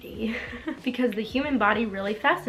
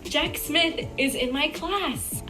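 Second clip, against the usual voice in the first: giddy; 5 semitones higher, slower than usual. Retold or imitated. Giddy crush line read aloud.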